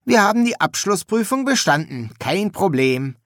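put-on high, buoyant young student; breezy, upbeat triumph; cheerful. A narrator's reading. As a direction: celebrate it as no big deal. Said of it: "sagte Shelter fröhlich".

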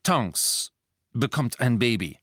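reluctant, halting announcement; forced admission. A narrator's reading. Lupin admitting that his wife is pregnant, forced. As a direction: force the unwelcome news out, pausing after the name. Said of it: "als ob er sich zwingen müsste, etwas Unangenehmes zuzugeben".

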